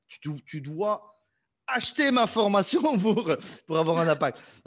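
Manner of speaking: jokingly hard-sell your own course; mock sales pitch. (argumentaire de vente moqueur en riant)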